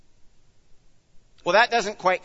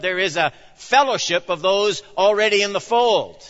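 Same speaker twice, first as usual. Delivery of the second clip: louder, higher, driving sermon voice; full-force preaching; 5 semitones higher, 6 dB louder than usual. Acted. Pastor preaching hard to his flock, loud and driving.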